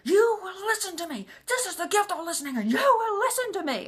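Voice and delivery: high, loud, furious yell; screaming. Retold or imitated. Husband shakes his wife in the kitchen, screaming. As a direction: scream it at the top of your lungs. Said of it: "screaming at the top of the lu".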